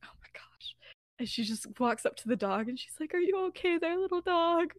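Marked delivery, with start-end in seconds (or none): 0.0-0.7 voiceless, very soft aside; acted hushed disbelief; whispered dismay. Lily appalled, under her breath. 3.1-4.7 higher, soft voice to a little dog; acted tender concern for an animal; gentle pet talk. Lily comforts the stray dog.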